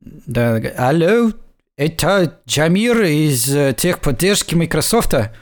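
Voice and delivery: lightly put-on Chinese accent on Russian words. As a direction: play a tech support caller with a hint of a Chinese accent. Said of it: "у меня какой-то китаец получился".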